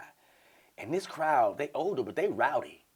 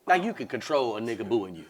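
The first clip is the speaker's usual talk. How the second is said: ordinary delivery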